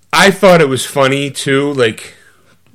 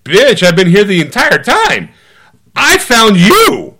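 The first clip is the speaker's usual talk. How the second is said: sassy local; 7 semitones higher, 4 dB louder, slower than usual. Pretend. Voices the bloke behind the counter.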